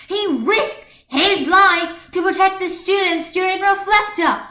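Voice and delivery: loud, high, heated listing; angry rant. A narrator's reading. Marinette ranting at Adrian defending Chat Noir, loud angry. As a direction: fire off every point at him. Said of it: "In the middle of her rant".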